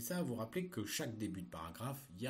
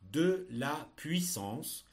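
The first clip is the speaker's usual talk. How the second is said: slow, loud and distinct dictation; dictating; 3 semitones higher, 4 dB louder, much slower than usual. Shown as a demonstration. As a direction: read each word out for pupils to copy. Teacher dictating text to pupils.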